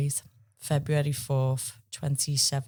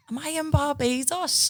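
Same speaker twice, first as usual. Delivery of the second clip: woozy confused voice, much higher; dazed high question; 11 semitones higher than usual. Retold or imitated. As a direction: play just waking from a knockout. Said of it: "I was going".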